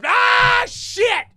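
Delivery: sudden loud, high cry of alarm; startled shout. Retold or imitated. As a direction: yell it like you just saw someone in front of the car. Sudden braking, reliving the shock.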